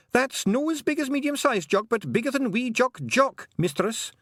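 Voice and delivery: a wee Scottish pixie chirping up to Tiffany; Feegle pixie voice. Acted. Pixie telling Tiffany his long name, high and eager.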